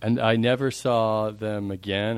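slow. Shown as a demonstration. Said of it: "what was your line that you gave me in a song".

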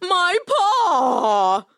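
high, wailing lion in pain; crying in pain. A narrator's reading. Lion hurt by a thorn in his paw, wailing. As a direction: wail it out, long and high. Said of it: "the lion began to cry".

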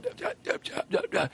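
put-on whispered stutter of a drunk fan trying to say a name; drunk stammering fan. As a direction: choke on the name, too excited. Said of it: "he can't get it out of his mouth".

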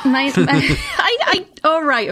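stage fright. Real, not acted. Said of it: "I'm so nervous right now".

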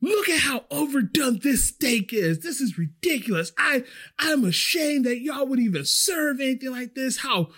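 high-pitched mock customer outrage; mock yelling. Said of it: "She's, like, yelling at me".

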